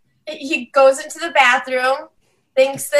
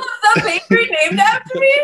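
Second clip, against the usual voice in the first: high, quick mock curiosity; imitating celebrity viewer; 9 semitones higher, 3 dB louder, much faster than usual. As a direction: play the flattered celebrity, high and fast. Imitating celebrity asking about bakery.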